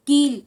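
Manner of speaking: teacher holding the long ई in कील; long ee sound. Shown as a demonstration. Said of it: "इसके उच्चारण में ज़्यादा समय लगता है".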